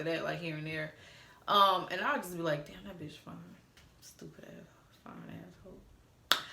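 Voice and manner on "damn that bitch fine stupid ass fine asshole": hushed, breathy whisper under her breath; whispered aside. Retold or imitated. Admiring a fine man, whispered to herself. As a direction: murmur it so only you hear.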